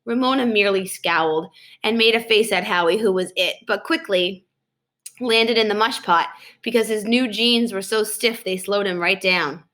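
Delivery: usual speaking voice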